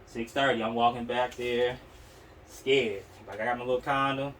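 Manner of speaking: usual speaking voice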